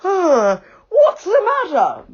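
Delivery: high, loud hammed-up sleepy fluster. A narrator's reading. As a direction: pretend to wake with a start. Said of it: "in a sleepy voice".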